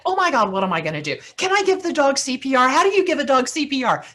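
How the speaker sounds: panicking. Retold or imitated. Lucy finds her boss's dead dog, high frantic panic. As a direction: blurt it out in a frantic rush. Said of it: "So Lucy panics".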